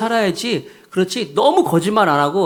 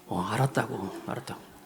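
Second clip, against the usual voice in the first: meek mumble; 6 semitones lower, 13 dB quieter than usual. Retold or imitated. Scolded husband gives in.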